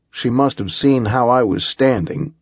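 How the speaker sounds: usual speaking voice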